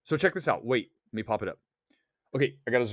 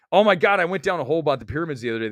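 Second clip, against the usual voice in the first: usual speaking voice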